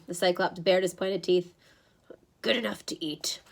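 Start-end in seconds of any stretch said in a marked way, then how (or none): 2.4-3.4 menacing whisper. A narrator's reading. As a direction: breathe it like a threat. Monster menacing.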